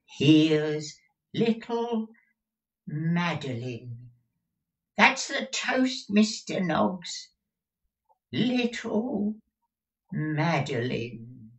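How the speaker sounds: high, slow, doting toast; doting old miser. Acted. Old miser toasting his young bride, high and slow. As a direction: croon her name like a prize.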